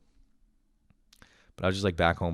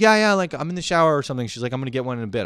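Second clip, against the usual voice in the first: sister brushing off; 6 semitones higher, 5 dB louder, much faster than usual. Retold or imitated.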